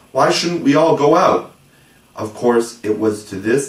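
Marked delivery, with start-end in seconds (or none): 0.1-1.5 higher, louder eager call; eager cry. A narrator's reading. John proposes flying outside, eager cry. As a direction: burst out with an idea. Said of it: "cried John".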